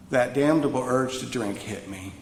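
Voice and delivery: normal talking voice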